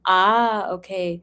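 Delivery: ordinary talk, nothing marked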